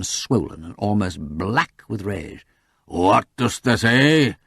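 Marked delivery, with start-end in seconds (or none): 2.9-4.4 slow, enraged farmer's challenge; thick-voiced demand. A narrator's reading. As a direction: drag it out thickly, full of rage. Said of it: "he demanded thickly".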